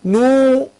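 نو with the long oo held out; drawn-out madd vowel. Shown as a demonstration. Teacher isolates the waw of madd, نو held long. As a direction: stretch the oo of نو well past normal length. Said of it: "حروف المد وهم جمعوها في كلمة".

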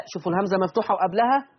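usual speaking voice

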